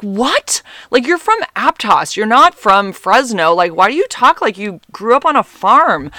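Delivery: high, loud retold protest; exasperated disbelief. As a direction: challenge him in mock outrage.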